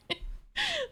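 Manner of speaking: squealing laugh. Real, not acted. Laughing at own roar.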